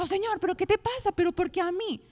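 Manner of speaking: mock exasperated complaint. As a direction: play it as an annoyed, self-pitying complaint.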